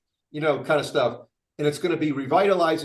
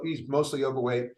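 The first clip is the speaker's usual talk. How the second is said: usual speaking voice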